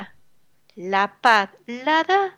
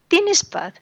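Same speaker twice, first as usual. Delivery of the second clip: ¿Tienes paz? asked much higher, hitting "Tienes"; pointed question; 8 semitones higher than usual. Acted. Preacher challenging the unfaithful husband, high pointed question. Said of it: "preacher repeats the question".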